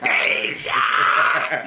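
hammed-up grieving yell of her name; mock wail over a fallen friend; mock anguished cry. Acting out a movie death scene.

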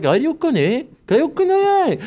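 put-on the girls ask each other in babble; mock Korean gibberish. Comic voicing both girls' puzzled mock Korean. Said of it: "They're both like".

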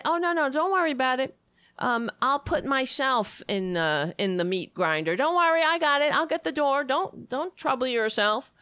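high, sarcastically obliging voice; hammed-up helpfulness, pitched high; mock eager volunteer. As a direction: parody someone cheerfully offering to sacrifice themselves. Voices eager, unquestioning sheep.

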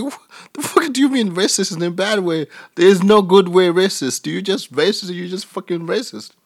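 high-pitched, incredulous retort; indignant disbelief. Retold or imitated. Retold outraged reply to girlfriend.

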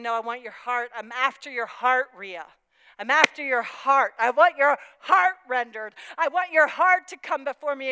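loud, pressing declaration; insistent. Acted. God insisting in sermon.

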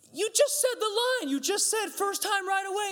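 very high, incredulous parent. Retold or imitated. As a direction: scold in exasperated disbelief. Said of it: "I probably raised my voice a little bit".

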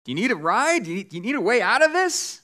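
lightly acted shout, not full volume; mock shout down. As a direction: call out an offer from overhead. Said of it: "shouts down and says".

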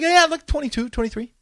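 high-pitched youngster impression; mock youthful high voice; young pilot. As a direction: answer offhand like a kid pilot. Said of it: "this twenty-two-year-old kid flying the plane".

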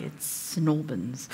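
low, slow, hushed Snowbins, drawn out; correcting lady. Retold or imitated. A local lady taking her aside to correct her, low and hushed. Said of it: "this lady took me aside and was like".

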